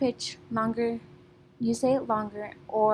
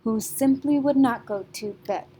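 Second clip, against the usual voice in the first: louder line with beats on simply, not, bed; stressed beats; 5 dB louder than usual. Shown as a demonstration. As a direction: hit the first syllable of simply, then not and bed. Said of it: "your stressed syllable is your beat".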